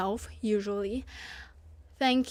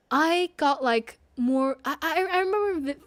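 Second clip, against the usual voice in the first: normal talking voice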